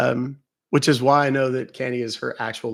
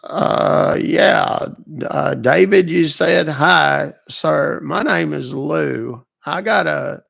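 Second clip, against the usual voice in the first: higher, slow folksy old man playing dumb; put-on old man Lou; 4 semitones higher, 9 dB louder, much slower than usual. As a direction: play a slow, confused old caller. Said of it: "He was questioning my voice".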